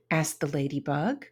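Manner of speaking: plain reading voice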